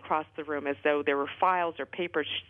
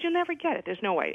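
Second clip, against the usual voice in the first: mock husband; 9 semitones higher than usual. Husband dismissing her chances.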